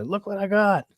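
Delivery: gleeful boast. Pretend. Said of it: "I'll say".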